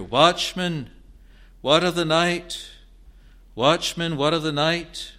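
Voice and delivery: a desperate night crier pleading for news; urgent cry. Acted. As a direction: call out loud, high and urgent. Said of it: "pierced with an urgent cry".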